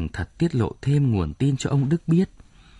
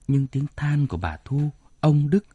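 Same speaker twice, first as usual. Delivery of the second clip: usual speaking voice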